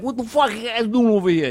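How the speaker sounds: a hint of a brash Italian accent. Shown as a demonstration. Demo for fans, light Italian accent. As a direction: toss off a quick Italian-accented jab. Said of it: "like, an Italian accent".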